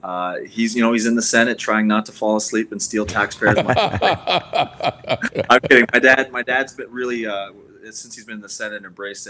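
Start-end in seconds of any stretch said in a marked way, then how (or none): 3.8-5.4 hearty laugh. Real, not acted. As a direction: burst of real laughter, high-pitched. Joke about dad in the Senate.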